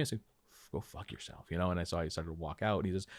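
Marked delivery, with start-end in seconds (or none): 0.7-1.5 hushed insult. Retold or imitated. Retold insult to rude director.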